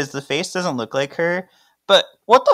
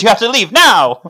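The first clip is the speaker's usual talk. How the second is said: loud, raised-pitch mock alarm; urgent acted order; 8 semitones higher, 9 dB louder than usual. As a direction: shoo them out, rising to a shout. [name] urgently orders Paige out.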